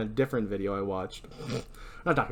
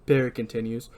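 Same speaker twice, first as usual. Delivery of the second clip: usual speaking voice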